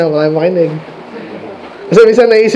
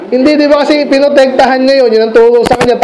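ordinary talk, nothing marked

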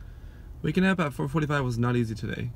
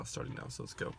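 whispering; 12 semitones lower, 14 dB quieter than usual. Real, not acted. Early gym, whispering.

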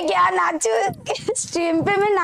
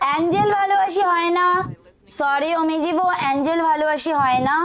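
ordinary delivery

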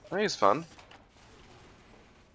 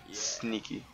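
softer sneaky aside; quiet; 11 dB quieter than usual. Real, not acted. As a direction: drop to a hushed sneaky voice. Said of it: "Sneaky sentry".

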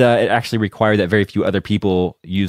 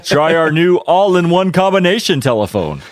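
hammed-up announcer selling a gadget; big salesy pitch, slower; old-time radio ad voice; 8 semitones higher, 4 dB louder than usual. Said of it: "that's the ad you would read".

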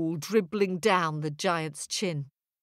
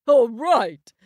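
giant's wife voice; 4 semitones higher, 9 dB louder than usual. Acted. Giant's wife agrees loudly.

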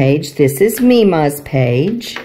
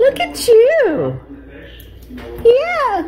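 high, slow cooing; slower than usual. Real, not acted. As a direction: coo it to a little one. Cooing at a baby.

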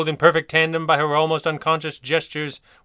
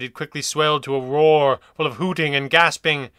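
usual speaking voice

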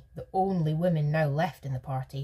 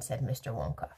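ordinary delivery, nothing marked

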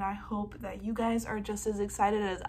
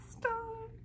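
squealed stop; 13 semitones higher, 7 dB quieter than usual. Real, not acted. Fan gasps at a photo.

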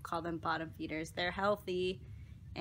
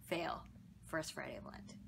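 soft whispered fail; 5 semitones lower, 12 dB quieter than usual. Real, not acted. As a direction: breathe it out as a whisper. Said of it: "admits cheating on Lent".

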